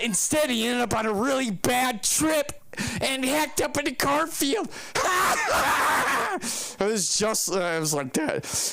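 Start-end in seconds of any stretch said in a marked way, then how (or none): 4.7-6.7 shrieking ghoul laugh; high evil cackle. Retold or imitated. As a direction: cackle like a skeleton host. Ghoulish laugh after crypt voice.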